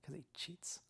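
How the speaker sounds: barely audible whispered aside; whispered jibe. Real, not acted. Friend heckling the explanation of a time traveler, whispering. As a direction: slip it in under your breath, whispered.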